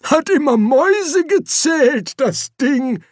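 put-on very high-pitched old man's voice; old wizard. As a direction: pipe up like an excitable old codger. Old Windle Poons chimes in.

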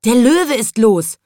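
loud, slow alarmed shout; shouting policeman. A narrator's reading. Policeman shouting the lion is loose, loud and slow. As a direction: call out the news in alarm. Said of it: "schrie der Polizist".

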